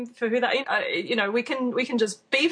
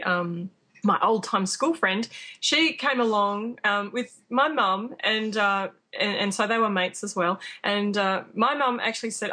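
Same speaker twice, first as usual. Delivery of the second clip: normal talking voice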